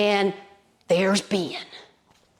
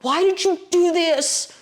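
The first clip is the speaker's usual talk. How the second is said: high-pitched, anguished demand, drawn out; angry cry to God; 8 semitones higher, slower than usual. Retold or imitated. Storyteller voices angry boy questioning God after the flood, angry cry. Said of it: "He was angry".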